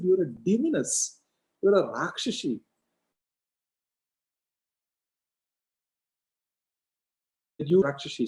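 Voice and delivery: high-pitched accusing name-calling; retold denunciation. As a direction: accuse in storyteller's mock horror. King denounces the queen.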